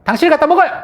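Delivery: very high, loud acted retort; offended wife snapping back. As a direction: shoot back at the husband in a huff. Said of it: "아내는 기분 탁 상하는 거예요". (기분 상해서 쏘아붙이는 아내 말투)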